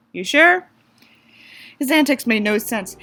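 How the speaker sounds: usual speaking voice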